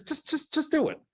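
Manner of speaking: mock insistent mentor; imitating Allison Janney. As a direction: nudge him on in her voice. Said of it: "so she goes".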